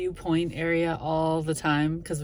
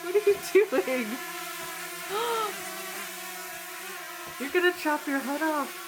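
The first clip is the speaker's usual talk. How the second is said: panicked; 13 semitones higher than usual. Real, not acted. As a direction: panic as it nearly crashes. Drone crash panic.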